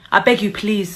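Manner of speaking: normal talking voice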